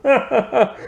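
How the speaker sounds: high, breaking into laughter; laughing exclamation. Real, not acted. Reviewer cracking up at Sacagawea cameo, laughing. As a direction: laugh through the words in disbelief.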